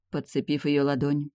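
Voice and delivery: ordinary delivery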